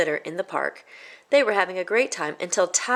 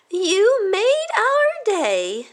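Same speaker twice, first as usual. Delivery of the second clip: very high, louder, very slow; sweet old lady; 6 dB louder, much slower than usual. Acted. Elderly lady thanking Michael at the senior home. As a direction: thank him like a doting grandma.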